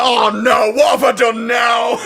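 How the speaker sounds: loud, high mock panic shout; half-laughing mock dread. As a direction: yell it like a hammed-up alarmed traveller. Retold panic at airport security.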